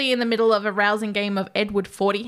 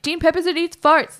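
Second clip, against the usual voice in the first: higher-pitched prank announcement; imitated prank broadcast; 7 semitones higher, 4 dB louder than usual. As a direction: call it out over the airwaves. Imitating prank message over radio.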